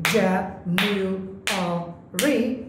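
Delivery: জ্যা নিউ আ রি split into four clapped beats; clapped syllables. Shown as a demonstration. Teacher clapping out জ্যা নিউ আ রি for students, slow and beat by beat. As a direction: clap out জ্যা, নিউ, আ, রি one by one. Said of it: "জানুয়ারিতে আমরা যদি clap দেই".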